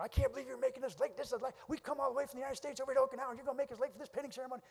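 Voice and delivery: high, loud New York rant; mock angry tirade. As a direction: imitate a New York dad giving his wife an earful. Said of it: "he was just giving it to her".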